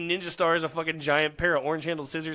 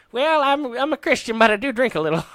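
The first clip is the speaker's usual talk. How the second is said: put-on high, loud old lady voice; old lady caller; 8 semitones higher, 8 dB louder than usual. Old lady calling in to a radio show, high and loud. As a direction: play the sweet old church lady confessing. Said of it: "She was like".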